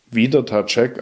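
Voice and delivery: plain reading voice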